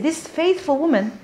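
ordinary talk

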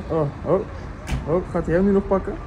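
ordinary delivery

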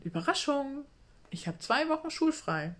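excited. A narrator's reading. Excited friend announces surprise.

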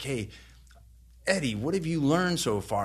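lightly voiced presenter putting him on the spot; seminar speaker calling out. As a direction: call out across the room like a presenter.

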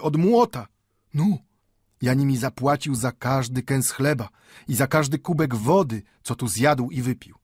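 put-on old Mendel impassioned. A narrator's reading. Old bookbinder shows his worn body as payment, impassioned. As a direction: list the hardships with rising, sing-song insistence.

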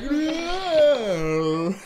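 drawn-out high "No"; slow motion voice. Retold or imitated. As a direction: stretch the no like a replay. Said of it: "I did not know how to do a slow motion voice".

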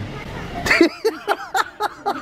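high unvoiced wheeze of a laugh; real laughter, mostly breath; breathy wheezing laugh. Real, not acted. Laughs at his own joke.

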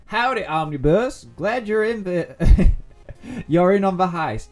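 loud, hammed-up Old West cowboy drawl; cowboy accent. Gamer greets viewers as an Old West cowboy, loud and hammy. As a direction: greet the crew like a Wild West outlaw. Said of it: "I've gotta do an accent".

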